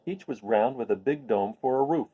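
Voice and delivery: plain reading voice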